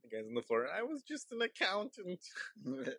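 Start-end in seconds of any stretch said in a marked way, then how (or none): 0.7-2.2 high, soft, slow lament; small high voice of a hapless victim; pitiful accountant. Acted. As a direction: whimper it like a beaten office worker. Acts out the floored office worker.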